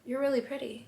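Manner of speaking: soft, understated compliment; low-key flirting. Shown as a demonstration. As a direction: pay her a quiet, flirty compliment. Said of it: "You'll go".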